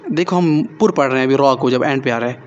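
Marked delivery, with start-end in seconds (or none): none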